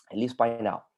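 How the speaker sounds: usual speaking voice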